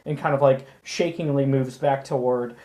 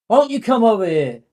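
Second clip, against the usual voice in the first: higher, louder, quick taunting call; pushy thug; 7 semitones higher, 10 dB louder, faster than usual. Acted. Drunk thug orders the shop owner over, loud and pushy. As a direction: beckon her like you own the street.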